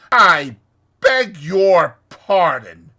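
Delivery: slow, loud, pointed words with gaps. A narrator's reading. Rat taking Toad up on his plan, slow. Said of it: "said the rat slowly".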